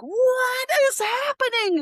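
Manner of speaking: high, slow incredulous cry; retold shock at the hacked bush, high and stretched. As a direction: disbelief at the ruined bush, drawn out high.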